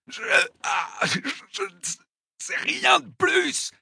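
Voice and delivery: high, broken gasps of pain; panting. A narrator's reading. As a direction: stammer it out between gasps. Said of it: "haleta-t-il".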